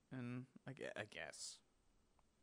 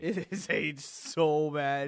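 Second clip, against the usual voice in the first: laughing; 4 dB louder, much slower than usual. Real, not acted. Laughing about old game.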